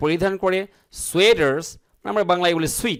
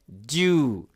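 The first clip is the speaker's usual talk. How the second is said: ডিউ as one long "joo", American style; American pronunciation; 3 semitones lower, much slower than usual. Shown as a demonstration. Teacher tells friends the American pronunciation, ডিউ with long oo. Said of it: "American English যেটা".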